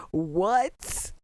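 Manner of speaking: ordinary delivery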